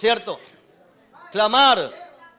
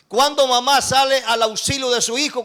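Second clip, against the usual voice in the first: ordinary talk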